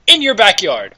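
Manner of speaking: ordinary delivery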